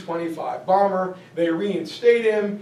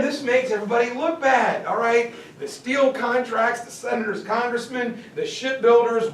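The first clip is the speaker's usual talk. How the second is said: ordinary delivery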